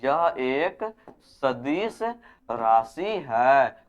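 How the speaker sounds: very slow, each word drawn out for note taking; slow classroom dictation; dictation pace. Shown as a demonstration. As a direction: say it slowly so they can write it down. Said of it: "तो लिख देना कि".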